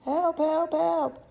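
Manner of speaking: high, loud cries for help; boy yelling help, pitched high and loud. Acted. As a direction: scream for rescue from the chocolate. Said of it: "he yelled".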